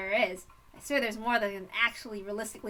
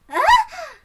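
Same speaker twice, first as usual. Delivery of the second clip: high, loud yelp; sudden sharp "ah" pitched way up; raised-voice cry; 14 dB louder than usual. Acted. Rena crying out at the shy girl's hesitation, high and loud. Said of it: "Rena raised her voice".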